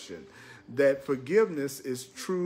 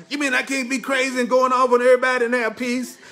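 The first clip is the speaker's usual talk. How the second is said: much higher, louder and fast, playing a naive listener; mock incredulous question; 9 semitones higher, 9 dB louder, faster than usual. Preacher mocking a listener who wants peace and anger, high and fast. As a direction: squeak it out like a scandalized churchgoer.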